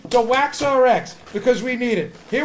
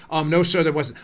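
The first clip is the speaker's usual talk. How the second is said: low, soft courtroom answer; quiet witness; 8 semitones lower, 5 dB quieter than usual. Acted. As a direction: answer meekly, low and trailing away. Witness answer, too quiet to hear.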